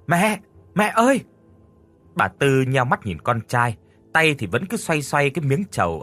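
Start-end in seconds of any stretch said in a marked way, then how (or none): 0.1-1.2 high, loud call to his mother. Acted. Son running up from the lake, calling out loudly. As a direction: son shouting for mother from afar. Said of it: "Anh đã gọi to".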